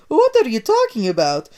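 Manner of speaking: high, loud baffled Italian; Mario baffled by accusation, loud confused. Acted.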